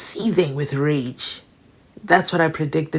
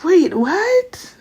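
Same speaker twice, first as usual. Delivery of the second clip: high, startled wait what; a mock startled squeak; shocked double take; 13 semitones higher than usual. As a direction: jolt back in surprise. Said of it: "I was like".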